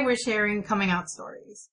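normal talking voice

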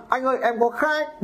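mock sweet broker. Seller mimics a broker calling about buyers, in a sugary put-on voice. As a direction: coo it like a salesman angling for a deal. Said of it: "Thằng nào cũng bảo có khách".

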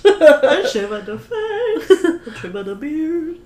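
high, slow hammed-up showman voice; mock Italian barber. Friend imitates Pirelli's barber boast, high and hammed-up. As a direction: ham up a boastful barber's pitch.

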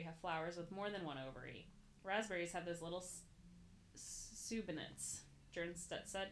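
normal talking voice

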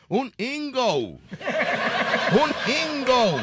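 high "un ingo" with a long "ou" ending; mock English. Shown as a demonstration. Host teaching Eva the answer, exaggerated English accent. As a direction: stretch "ingo" like a gringo. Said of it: "tienes que decir".

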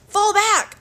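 urgent call to retreat mid-battle; urgent order. Acted. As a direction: call the retreat over the fight. Percy commands Clarisse in battle.

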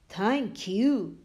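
a hint of a big dragon. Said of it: "said the dragon".

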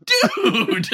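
amused, laughing dude. Real, not acted. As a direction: crack up on the word. Laughing at friend's story.

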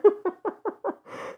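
high burst of giggling; laughter. Real, not acted. Friend recalls nearly falling to the floor, laughing.

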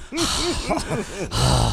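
sheepish laugh into the mic; embarrassed laugh. Real, not acted. Caught breathing on mic.

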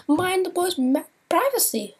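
high mock voice of the server with a pointed warning; lightly voiced pointed reminder; mock bread guy warning. Bread guy hinting at the bathroom incident, high mock voice. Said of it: "then he's like".

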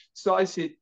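ordinary talk

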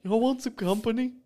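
high, silly come-on; goofy furry voice. Acted. Furry offering couples company, goofy high voice. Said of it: "a goofy-ass fucking voice".

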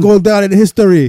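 loud, high hype-man DJ voice; mock hype DJ. Mocking the BBC DJ hyping [name]'s freestyle, loud and high. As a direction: ham up an overexcited DJ. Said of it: "DJ hyping up this freestyle".

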